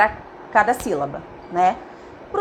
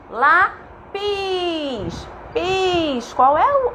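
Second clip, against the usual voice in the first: "Lápis" broken up, "Pis" long and high; stretched syllables; 5 semitones higher, much slower than usual. Shown as a demonstration. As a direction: draw out the I of "Pis" at a higher pitch. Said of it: "a vogal que eu ouço?".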